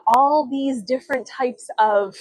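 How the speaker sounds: normal talking voice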